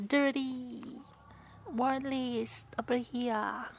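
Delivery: usual speaking voice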